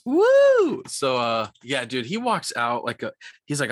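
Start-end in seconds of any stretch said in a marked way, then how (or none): 0.0-0.8 loud high whoop of delight; sudden excited cheer. Real, not acted. Cheering about the heavy pour.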